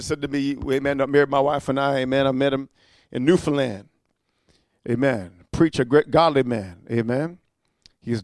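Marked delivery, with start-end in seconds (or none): none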